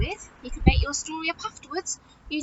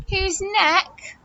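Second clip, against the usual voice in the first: usual speaking voice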